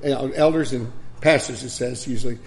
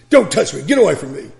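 put-on angry old man; 4 semitones higher, 8 dB louder than usual. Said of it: "And he says".

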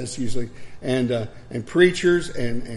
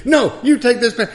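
much higher, louder mock scolding; angry old man; 10 semitones higher, 8 dB louder than usual. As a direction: mimic the old man with the pan. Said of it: "he says".

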